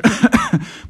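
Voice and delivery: high, loud coughing burst; acted cough. A narrator's reading. Grandfather before his nebulization, loud acted cough. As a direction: cough hard as the old man.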